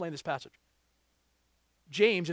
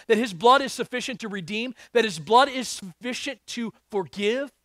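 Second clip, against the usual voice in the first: preaching; 3 semitones higher, 5 dB louder than usual. Acted. Preaching with rising force.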